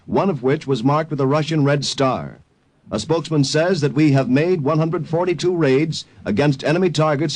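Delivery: brisk, urgent radio newsreader; news bulletin. Acted. Announcer breaking into the program with urgent war news. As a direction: read it like a breaking wartime bulletin.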